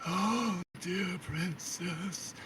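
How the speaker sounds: slow, high, soft creepy voice; drawn-out eerie croon; creep in your ear. Acted. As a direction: breathe it creepily right behind the ear. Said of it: "like, right behind your ear, and he's just".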